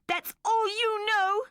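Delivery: put-on high, slow, loud child's shout. A narrator's reading. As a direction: hurl it at her mother. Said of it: "shouted Mandy".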